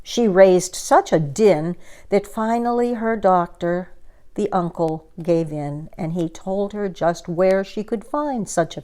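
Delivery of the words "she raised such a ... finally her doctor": plain reading voice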